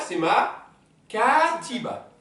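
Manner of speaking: katiba drawn out slowly, stress on the final A; model word. Shown as a demonstration. Teacher showing the feminine ending, slow katiba. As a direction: stretch katiba, land on the feminine A. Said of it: "je rajoute le A du féminin".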